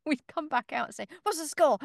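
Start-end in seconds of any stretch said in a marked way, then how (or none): 1.2-1.8 loud eager question, pitched up; bursting out with it; excited call. Retold or imitated. Mock sports question, coming out.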